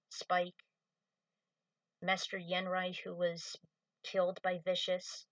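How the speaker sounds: normal talking voice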